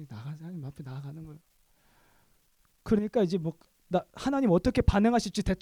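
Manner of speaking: ordinary delivery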